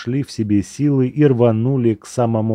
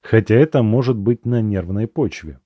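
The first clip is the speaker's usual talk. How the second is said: usual speaking voice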